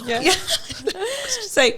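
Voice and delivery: high slow laughing line; real laughter. Real, not acted. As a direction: laugh through the words.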